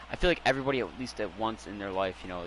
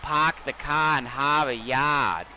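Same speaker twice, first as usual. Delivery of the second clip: slow, broad Boston accent mocking outsiders; exaggerated Boston accent, dragged out slow; classic Boston accent; 3 semitones higher, 4 dB louder, much slower than usual. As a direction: drop the r's like a hammed-up Bostonian. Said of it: "they give me the classic Boston accent".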